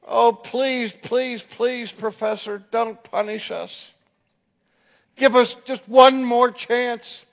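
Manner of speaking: high, begging student voice; pleading students. Retold or imitated. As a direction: plead with the professor like a scared freshman.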